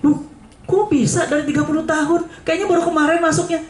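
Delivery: high, astonished disbelief; shocked high-pitched question; surprised. Retold or imitated. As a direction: gasp it out in disbelief. Surprised at short remaining sentence.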